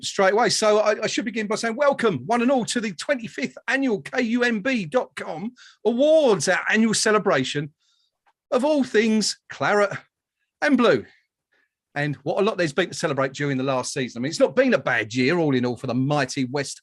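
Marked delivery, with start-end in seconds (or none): 0.0-11.1 grand, higher-pitched welcome to the audience; show host announcement. Acted. Host welcomes viewers to the annual awards, ceremonial announcement. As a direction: announce it like an awards host, drawing out key words.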